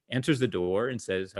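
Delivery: ordinary delivery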